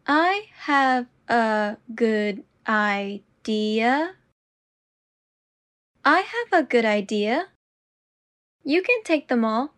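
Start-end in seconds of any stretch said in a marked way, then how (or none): 0.1-4.1 very slow and deliberate. Shown as a demonstration. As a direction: stretch each word out. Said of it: "repetition drill, slowed take".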